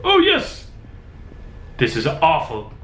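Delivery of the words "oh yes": high squeal of relief; excited shriek. Real, not acted. Relief in horror game.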